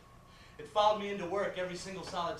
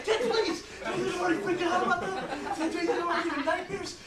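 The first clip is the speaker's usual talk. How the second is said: exasperated yelling; 9 semitones higher than usual. Acted. Son begging his mother to stop, yelling. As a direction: yell over her to make it stop.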